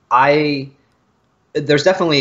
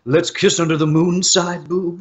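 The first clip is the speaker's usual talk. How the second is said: slow, mock-dramatic gunslinger line; slower than usual. Quoting a song lyric as a western gunman, slow mock drawl. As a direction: draw it out like a western villain.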